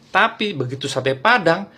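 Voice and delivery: usual speaking voice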